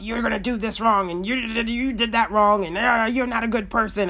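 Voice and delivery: mock the nagging inner critic; voice of Satan. Speaker voicing Satan condemning an anxious listener, lightly accusing. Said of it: "Satan condemns you".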